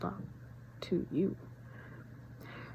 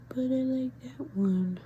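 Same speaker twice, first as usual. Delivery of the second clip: mimicking mom; 7 semitones higher, 4 dB louder, slower than usual. Mom's quoted reply, put-on voice.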